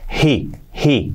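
He with a soft breathy H, not a Spanish jota; soft aspirated H. Shown as a demonstration. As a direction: breathe out the H gently. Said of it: "Una H suave". (h aspirada suave)

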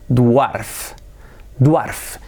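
"Dwarf" read as spelt, like "duarf"; mispronounced dwarf. Shown as a demonstration. Teacher showing his old reading, mispronounced dwarf. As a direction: say "Dwarf" with a full w glide. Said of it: "Y yo siempre decía".

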